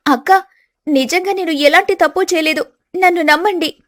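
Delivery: a wronged bride pleading; young wife pleading. Acted.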